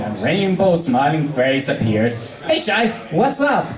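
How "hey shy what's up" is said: high, breezy tomboy voice with a laugh; Rainbow, relaxed greeting; Rainbow Dash. Acted. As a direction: greet her casually.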